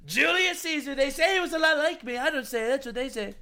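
high, put-on politician voice; mock campaign-rally boasting in a high voice; boastful politician impression. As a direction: do a bragging politician, pitched well up. Mimics a boastful politician on Colosseum.

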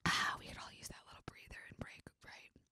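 soft breathy-free hushed aside; whisper. Real, not acted. Pausing for coffee, telling listeners to take a breather, whispered. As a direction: hush it like a secret to listeners.

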